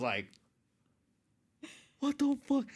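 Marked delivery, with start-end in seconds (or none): 2.0-2.7 high-pitched outraged squeal; reenacted shocked outburst; freaking out. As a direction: blurt it loud and very high in disbelief. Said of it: "I was freaking out".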